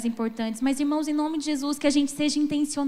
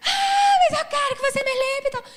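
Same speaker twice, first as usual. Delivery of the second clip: put-on high whining kid voice; whiny child; 9 semitones higher, 4 dB louder than usual. Mother mimics her crying son begging to be cleaned, high whine. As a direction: whine like a six-year-old who wants his way.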